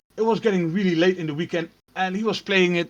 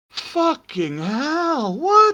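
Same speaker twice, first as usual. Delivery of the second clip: stunned disbelief; 10 semitones higher, much slower than usual. Retold or imitated. Retelling what both housemates said on hearing it, stunned. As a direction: stretch it out, then squeak in disbelief.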